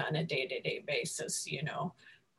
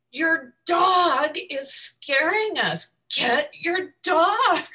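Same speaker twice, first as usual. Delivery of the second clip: slow, loud demand, each word pushed; retold yell; 7 semitones higher, 4 dB louder, slower than usual. Narrator yelling at the neighbor to get her dog, slow and loud. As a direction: order her firmly to grab the dog. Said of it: "I yelled at her".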